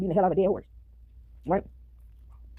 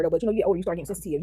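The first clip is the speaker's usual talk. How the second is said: usual speaking voice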